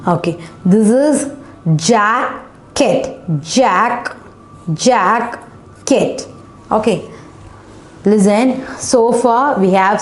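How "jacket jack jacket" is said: "jacket" broken into jack, pause, ket; syllable division. Shown as a demonstration. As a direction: sound out "jacket" in two separated beats. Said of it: "We just divided into two syllable".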